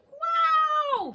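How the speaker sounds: very high, loud joyful cry; thrilled wow. Acted. Norman after his flight, high loud thrilled wow. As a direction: burst out in delight. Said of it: "said Norman".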